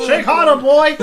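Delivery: loud, high barked order; mock command. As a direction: bark it like a drill sergeant.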